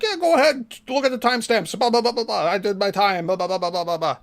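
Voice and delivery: high start, sliding down into blah-blahs; mock dismissive babble. Him brushing off the timestamps, mock dismissive. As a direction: rattle it off dismissively, imitating him. Mimicking his dismissive rambling exit.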